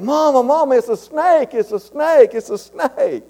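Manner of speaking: high, excited fake alarm calling to mother; mock snake alarm. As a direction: yell it like a kid pranking his mom. Child fakes a snake alarm.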